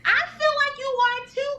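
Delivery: high excited agreement; eager yes. Real, not acted.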